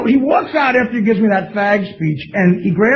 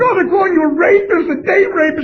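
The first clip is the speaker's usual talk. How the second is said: imitating crying mother; 8 semitones higher than usual.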